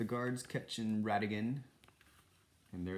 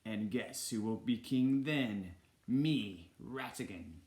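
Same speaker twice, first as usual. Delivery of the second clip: lightly voiced smug villain, drawn out; slow, pointed villain boast; Ratigan the villain; slower than usual. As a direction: play the scheming villain lightly. Villain voiced in read-aloud story.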